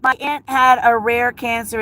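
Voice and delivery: ordinary delivery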